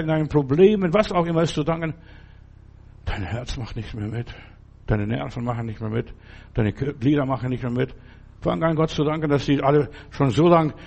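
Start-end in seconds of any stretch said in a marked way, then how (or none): 3.0-7.9 low, much softer lament; hushed. Real, not acted. Preacher softly naming the failing heart and nerves. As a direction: drop the voice to a near hush. Listing failing body parts.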